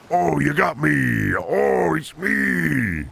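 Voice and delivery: high, drawn-out wailing impression; Germa. Streamer showing off a famous impression, high drawn-out wail. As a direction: stretch each cry up high and let it slide down. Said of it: "my famous German impression is".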